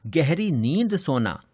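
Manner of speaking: usual speaking voice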